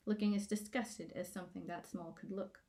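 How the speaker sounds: usual speaking voice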